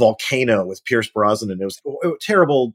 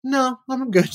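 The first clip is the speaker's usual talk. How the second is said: breezy; 12 semitones higher, slower than usual. Retold or imitated. Breezy refusal to read.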